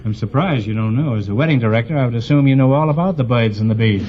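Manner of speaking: ordinary talk, nothing marked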